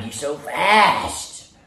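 very slow tender coo. Real, not acted. Owner telling his dog she's fast in a slow tender coo. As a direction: draw it out lovingly.

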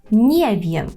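"nie wiem" joined, NIE stressed, falling. Shown as a demonstration. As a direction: hit NIE, drop "wiem". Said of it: "і наголошуємо".

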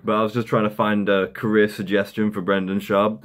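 ordinary talk, nothing marked

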